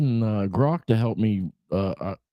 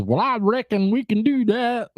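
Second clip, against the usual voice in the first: put-on high-pitched hillbilly accent; Gemini's hillbilly; 13 semitones higher than usual. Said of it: "speak to me from now on in hillbilly".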